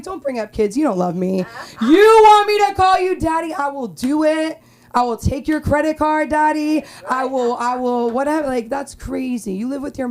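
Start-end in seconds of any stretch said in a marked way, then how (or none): 1.8-8.5 mock eager.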